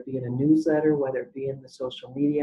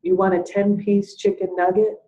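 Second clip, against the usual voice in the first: loud, higher question echoing the order; repeating as a question; 5 semitones higher, 7 dB louder than usual. Retold or imitated. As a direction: parrot the order back loudly with rising disbelief. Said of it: "repeats what you say".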